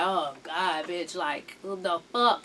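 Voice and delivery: low, soft mock groaning complaint; muted exasperation. Studio crowd tired of her neediness, muted exasperation. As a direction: sigh it out low, fed up.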